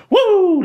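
whooping shout, very high and loud; hype yell. Real, not acted. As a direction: let out a big celebratory woo. Hyping up his own show.

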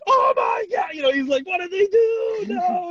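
high, loud shocked cry, imitating; imitating a streamer's horrified shriek; mock horror. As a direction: squeal like you just saw a disaster. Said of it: "Jeremy's like".

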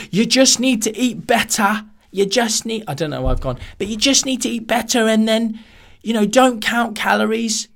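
high, bouncy fitness coach voice; Joe Wicks impression. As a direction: do a chirpy fitness guru. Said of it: "I'm probably doing Joe Wicks".

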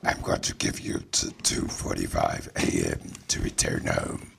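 deep sinister villain ultimatum; Candyman. Pretend. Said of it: "Oh, you mean Candyman?".

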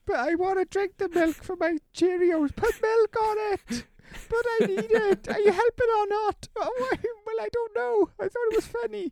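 put-on very high, loud, crying little-kid voice; whiny little brother. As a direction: whine like a small boy who wants his milk. Co-host voices the young brother.

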